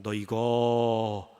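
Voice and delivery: slow, low, menacing warning; threatening housekeeper. Acted. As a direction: draw it out as a veiled threat. (느리고 낮게 위협하는 가정부)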